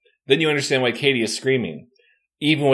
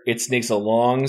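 usual speaking voice

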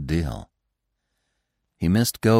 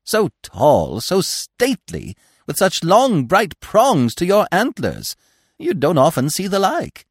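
high, slow squirrel gushing; rapturous; 11 semitones higher, 4 dB louder, slower than usual. A narrator's reading. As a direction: linger admiringly on the praised words. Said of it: "pressed his forepaws rapturously".

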